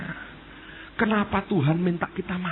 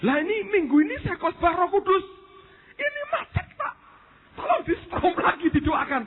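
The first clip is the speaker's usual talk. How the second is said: high, urgent pleading, rising to a cry; a retold preacher's frantic call for prayer; panicked plea; 12 semitones higher than usual. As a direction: beg for help in a panic, voice pitched up. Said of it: "Dia panik".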